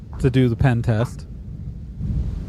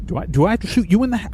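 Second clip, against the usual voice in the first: threatening; 8 semitones higher, much faster than usual. Acted. Boss repeats threat.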